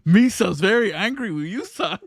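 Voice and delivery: high pidgin alien voice, laughing; silly gungan-style alien; Jar Jar style voice. Acted. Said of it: "give me more, like, sultry voice".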